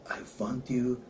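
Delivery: hushed, low, bad French accent; attempted French accent. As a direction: half-whisper in a shaky attempt at French.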